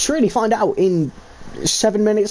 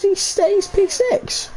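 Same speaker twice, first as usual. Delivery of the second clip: drawn-out reveal; much slower than usual. Acted. Dramatic race result reveal.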